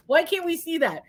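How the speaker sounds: ordinary talk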